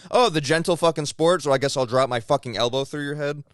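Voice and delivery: loud sarcastic rant; mock it loudly; sarcastic mock outrage. Mocking sarcastic retort.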